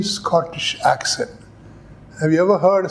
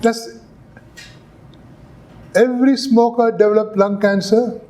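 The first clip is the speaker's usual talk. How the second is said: raised pitch, leading question; pointed question; 5 semitones higher than usual. Acted. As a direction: lead the witness to the answer. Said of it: "Fisher stood up".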